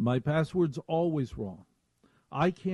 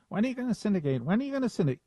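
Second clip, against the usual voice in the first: higher, whiny, pestering repeat; nagging voice; 7 semitones higher, much faster than usual. Retold or imitated. Chris Olivero nagging the host to syndicate, whiny voice. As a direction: nag him like a pest. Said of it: "In that voice".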